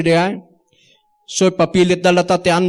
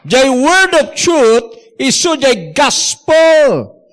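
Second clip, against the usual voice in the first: full-force preaching; 8 semitones higher, slower than usual. Real, not acted. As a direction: drive each phrase home to the church.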